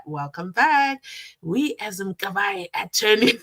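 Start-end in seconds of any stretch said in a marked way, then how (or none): none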